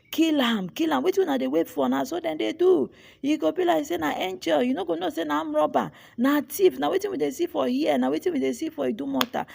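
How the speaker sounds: rowdy Pidgin mob chant, high-pitched; mob voices. Acted. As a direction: play a jeering Lagos market crowd.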